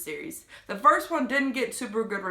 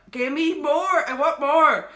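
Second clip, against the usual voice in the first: louder and higher, begging for more books; greedy excitement; 6 semitones higher, 6 dB louder than usual. Retold or imitated. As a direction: demand more like an eager fan. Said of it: "I was just like".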